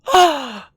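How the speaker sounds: big, high gasp of shock; loud crowd gasp. Acted. Shock goes across the audience, one large gasp. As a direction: suck air in loudly, scandalised. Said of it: "there's a large".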